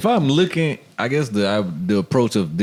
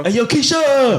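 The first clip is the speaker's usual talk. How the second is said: loud high call across the street; calling from far away; 6 dB louder, slower than usual. Pretend. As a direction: shout her name across the street. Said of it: "you yell over there".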